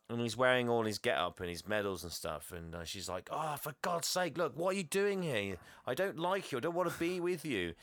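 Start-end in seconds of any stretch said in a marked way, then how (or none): 3.3-7.7 higher-pitched mock exasperation; acted-out fed-up protest, pitched up; exasperated rejection. Woman to the ex she dislikes, mock exasperated.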